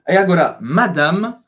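ordinary talk

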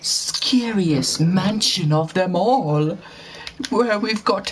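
scary host voice. Pretend. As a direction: play a creepy mansion host, slowly. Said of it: "like, scary voice".

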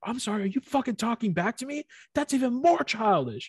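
hammed-up outrage; mock outraged rant. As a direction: act appalled at the back-talk. Retold rant at classmate.

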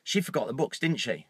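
low, hushed needling aside; soft, low insinuating murmur; negative inner voice. Retold or imitated. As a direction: plant the doubt quietly, like a voice in the head. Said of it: "the negative voice says to me".

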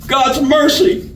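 high, drawn-out plea; stretched, high-pitched outcry; alarmed cry. A narrator's reading. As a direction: cry out for mercy. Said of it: "cried the targeted scoundrel".

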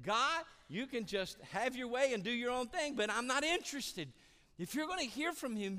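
high, very loud preaching shout; defiant outburst. Acted. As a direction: belt it out like someone refusing God. Preacher voices defiant congregation at full force.

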